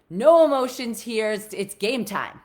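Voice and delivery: loud, firm order from a father; the dad's loud, curt ruling at match time; stern dad. Retold or imitated. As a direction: bark it like a dad cutting her off. Dad shuts down her feelings.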